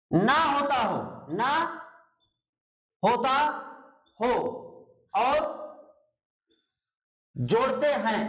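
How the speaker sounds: word by word, with long pauses between chunks; teacher's dictation. Real, not acted. Teacher dictating a spelling rule to students, halting dictation delivery. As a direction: dictate slowly, repeating pieces as you go.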